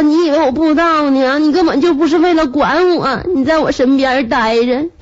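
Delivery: high, whiny, sustained mock complaint; feverish tearful ranting. Feverish wife accusing husband of using her as a stove, high mock whine. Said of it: "你烧糊涂".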